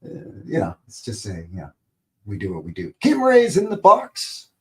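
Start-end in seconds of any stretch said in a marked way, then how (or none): 3.0-4.5 high, loud, drawn-out hail; excited announcement. Real, not acted. Host hailing a viewer arriving in chat, excited announcement. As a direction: call it out big and slow, pitched way up.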